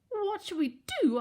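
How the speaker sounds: very high, worried piglet; panicked. A narrator's reading. Boris asks his brothers for a plan, panicked. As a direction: plead for an answer in fright.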